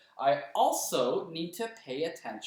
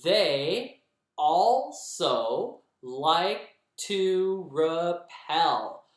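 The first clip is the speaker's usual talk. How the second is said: very slow and louder, syllable by syllable; clapped syllables; 2 semitones higher, 5 dB louder, much slower than usual. Shown as a demonstration. Teacher claps the second line's syllables for the kids. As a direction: pound out "They also like to rappel" beat by beat. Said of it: "You guys clap it".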